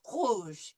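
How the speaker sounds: rouge with the r from the back of the throat; throaty r. Shown as a demonstration. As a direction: say rouge with a uvular r. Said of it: "make it come from back here".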